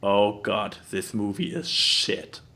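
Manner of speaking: low, slow, unenthused drawl; bored mock voice. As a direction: sound utterly unmotivated. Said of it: "she is like".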